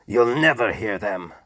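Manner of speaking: screaming. A narrator's reading. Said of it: "screamed the Duke".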